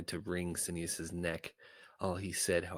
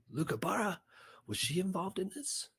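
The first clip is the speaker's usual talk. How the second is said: much higher, sharp startled question; alarmed surprise; 13 semitones higher than usual. Acted. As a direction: jump up in pitch, caught off guard. Sineas startled by news.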